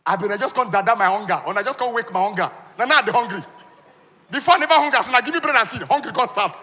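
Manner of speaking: high, loud, aggrieved; mock outraged guest; indignant hungry complaint. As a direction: rant like a starving guest offered only bread and tea. Guest complaining about bread and tea.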